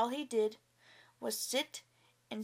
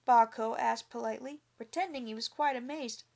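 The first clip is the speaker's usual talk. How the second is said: usual speaking voice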